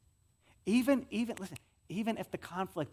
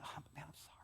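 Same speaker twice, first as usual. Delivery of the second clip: whispered condolence, very soft; hushed whisper of sympathy; sympathetic whisper; 2 semitones lower, 22 dB quieter, faster than usual. Shown as a demonstration. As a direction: breathe it like comforting the grieving. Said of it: "same phrase we use ... bad news".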